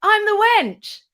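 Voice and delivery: high, loud eager announcement; star-struck fan introducing herself; excited blurt. Retold or imitated. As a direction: blurt it out breathlessly proud. Blurting who she is.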